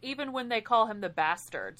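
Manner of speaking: ordinary talk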